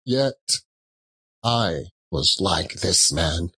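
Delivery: usual speaking voice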